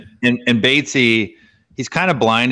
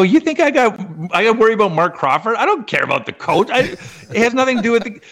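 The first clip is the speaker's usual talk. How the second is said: retold rant, much higher; high incredulous rant; 12 semitones higher, faster than usual. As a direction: pitch up, fast and indignant. Retold heated reply, pitched way up.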